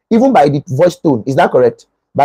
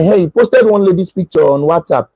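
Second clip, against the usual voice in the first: ordinary talk, nothing marked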